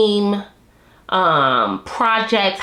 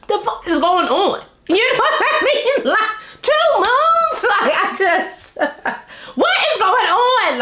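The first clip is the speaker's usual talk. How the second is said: much higher, laughing through the words; laughing disbelief; 12 semitones higher than usual. Real, not acted. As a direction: giggle in disbelief at two moons. Two moons, laughing disbelief.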